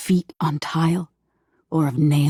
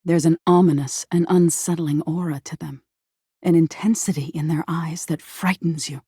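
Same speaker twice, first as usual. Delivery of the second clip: usual speaking voice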